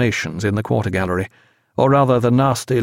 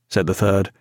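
usual speaking voice

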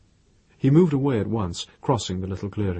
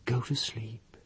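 tender; 7 dB quieter than usual. A narrator's reading. Tender to sleeping companion.

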